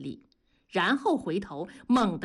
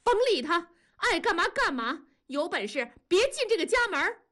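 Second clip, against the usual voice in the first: very high, furious shrill holler; yelling angrily; 11 semitones higher, faster than usual. A narrator's reading. Wife shouts from inside as husband leaves, bellowing. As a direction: yell it through the house in a rage. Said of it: "文丽在屋子里吼叫道".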